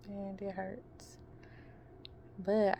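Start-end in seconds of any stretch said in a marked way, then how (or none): 0.1-1.2 slow, hurting whisper; pained whisper. Real, not acted. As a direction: breathe it out through the pain. In pain after knee surgery.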